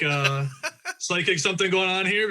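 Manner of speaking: amused at the coincidence, giggling; laughing. Real, not acted.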